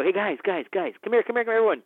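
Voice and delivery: high, loud call to the whole crew; hyped director rallying his crew on set. Retold or imitated. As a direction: gather everyone round and lift their spirits. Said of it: "I go".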